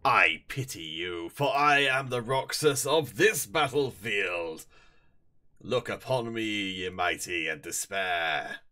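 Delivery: slow, booming boastful taunt; grandiose villain. Pretend. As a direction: declaim like a mighty conqueror. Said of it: "I'm trying to psych myself up".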